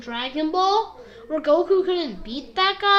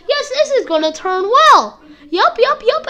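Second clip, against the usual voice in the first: high, loud mock optimism; sarcastically upbeat, pitched up; sarcastic cheer; 7 semitones higher, 8 dB louder than usual. Real, not acted. Commenting on a doomed Roshi fight, sarcastically chipper.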